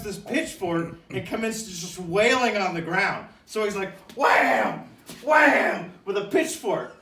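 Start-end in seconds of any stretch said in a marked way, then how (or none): 4.2-4.8 low loud thud; mock whack sound. Imitating Pat hitting the ground, a low loud wham. 5.2-5.9 high loud smack; mock whack sound. Imitating Pat hitting the ground, a higher louder wham.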